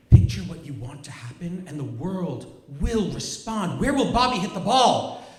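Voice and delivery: rising, eager coaching voice, pushing up on the question; imitating an eager mother's push; urgent mock coaching. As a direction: hype up a kid at a ballgame.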